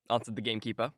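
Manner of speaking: ordinary delivery, nothing marked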